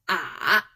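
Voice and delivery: "ah" sinking low, then turning up; dipping third tone. Shown as a demonstration. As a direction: dip "ah" down and back up. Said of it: "Final ah in the third tone sounds like".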